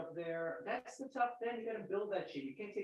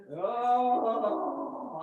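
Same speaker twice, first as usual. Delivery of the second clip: long loud "oh"; re-enacted hospital moan; 5 dB louder than usual. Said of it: "I was like that in the hospital".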